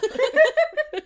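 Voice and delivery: high, loud peal of laughter. Real, not acted. Hosts laughing at their own joke, loud cackle. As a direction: burst out laughing.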